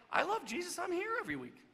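high, loud-ish mock-pious voice; self-satisfied churchgoer. Preacher mimicking an every-week churchgoer, high mock-pious voice. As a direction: pitch it way up, a mock devout churchgoer.

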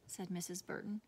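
usual speaking voice

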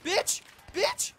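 very high, loud repeated shrieks; frantic screaming. Real, not acted.

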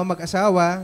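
ordinary talk, nothing marked

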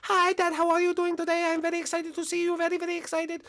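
put-on very high, fast, overjoyed kid voice; tiny high voice bursting with joy at seeing dad; squeaky excited Lemmy. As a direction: squeal it out like an overexcited little cousin. Lemmy's voice continues.

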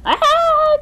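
high, loud shriek of alarm; farmer's shriek. A narrator's reading. Farmer sees a goblin, high loud shriek. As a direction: shriek out at the top of the voice. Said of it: "shrieked the farmer".

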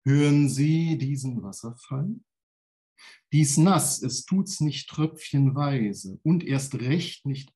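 poem recital. Acted. Poet reciting his waterfall poem to the listeners. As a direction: declaim the waterfall grandly.

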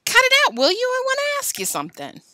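high, loud outburst easing off in volume; high-pitched forced cheer; hearty, quavering. A narrator's reading. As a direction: burst out with nervous heartiness. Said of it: "in a hearty, quavering voice".

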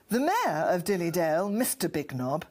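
normal talking voice

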